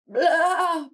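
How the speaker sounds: high, loud bleat; goat bleat. A narrator's reading. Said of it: "bleated the Goat".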